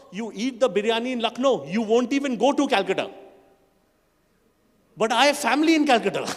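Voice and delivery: usual speaking voice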